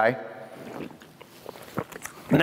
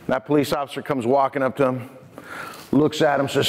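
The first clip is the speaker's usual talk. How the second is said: ordinary talk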